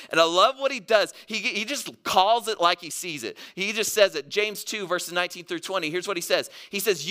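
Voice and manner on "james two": ordinary talk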